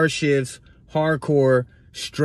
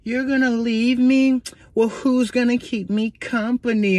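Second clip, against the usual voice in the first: imitating needy woman; 7 semitones higher than usual. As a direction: draw it out in a high, needy female voice. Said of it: "she gives me that little look".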